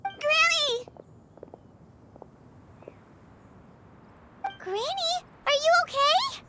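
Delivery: high-pitched, alarmed call to a loved one; worried calling out. Acted. Grandchild checking on granny, worried. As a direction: call out with rising concern.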